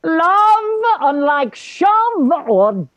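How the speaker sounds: loud high soaring declaration of love; grand proclamation. Acted. Fess wearing the sheet, proclaiming love grandly. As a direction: declaim it like a bard in rapture. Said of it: "He proclaims".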